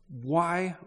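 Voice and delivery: a sharp, high, indignant "Why?". Acted. Reader voices the indignant guests at the feast, high and sharp. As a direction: spit it out in high protest. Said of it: "for putting some emotion into reading this".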